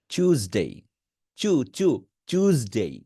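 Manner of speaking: টিউসডে as "chooz-day", চু then a thick জ; chooz-day pronunciation. Shown as a demonstration. Teacher showing learners the চু-জ টিউসডে. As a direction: start টিউসডে with চু, buzz a heavy জ. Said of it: "এটাকে মোটাভাবে করবেন".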